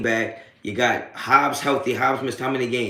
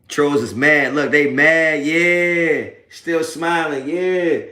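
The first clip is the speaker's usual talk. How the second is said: higher and louder, taunting gloat; 4 semitones higher, 7 dB louder, much slower than usual. Real, not acted. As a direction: rub it in loudly. Gloating loudly at angry trolls.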